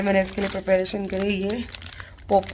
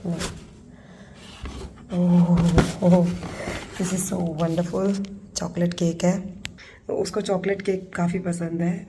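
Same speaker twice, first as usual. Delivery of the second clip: softer, hushed so the son won't hear; hushed secret voice; 2 semitones lower, 7 dB quieter than usual. Real, not acted. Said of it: "मैं धीरे बात कर रही हूँ". (बेटे से छुपाकर धीमी, दबी आवाज़ में)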